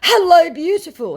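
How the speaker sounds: high, loud mock-sweet hello; mock scammer charm. Scammer flattering her again, high and mock-sweet. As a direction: ham up the fake flattery. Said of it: "he says, plowing on regardless".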